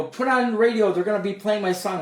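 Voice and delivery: mock-proud call to put the radio on; bragging to his sister in the car, excited and loud; excited boast.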